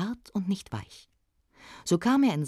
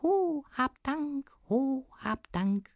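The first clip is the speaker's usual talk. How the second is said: high, slow, drawn-out dwarf voice; high-pitched lingering thanks; little smith's echo; 10 semitones higher, much slower than usual. Acted. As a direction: intone it slowly like a distant goblin call. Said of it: "Es klang ihm wie".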